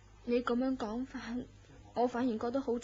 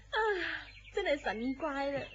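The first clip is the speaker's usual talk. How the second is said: usual speaking voice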